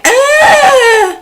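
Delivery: a high hammed-up ah; a bored victim screaming on cue; mock scream. Guest mocking the victim on the hook, with a high mock scream. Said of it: "she's just standing there going".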